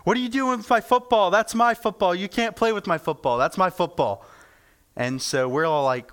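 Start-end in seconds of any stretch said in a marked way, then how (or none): 0.0-4.3 put-on screaming kid. Kid screams at boys over his football, high mock yell. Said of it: "this kid comes out screaming at us".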